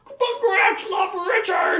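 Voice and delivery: very high, drawn-out mock wail; crying creature Gratch. Rejected Gratch pleading with Richard, high creature wail. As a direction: plead in a hurt beast voice. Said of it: "Gratch goes crying".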